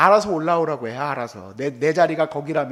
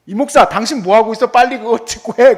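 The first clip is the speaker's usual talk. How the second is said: loud, bossy mock rebuke; mock scolding; 3 semitones higher, 9 dB louder than usual. Elder pastor scolds young pastor, loud mock rebuke. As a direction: bark it like an irritated elder.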